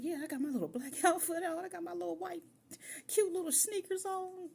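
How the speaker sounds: high, bubbly, showing off the outfit; proud and giddy. Retold or imitated. Proud girl answering her boyfriend, high and giddy. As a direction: chirp it like you're thrilled with your look. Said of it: "And I'm thinking".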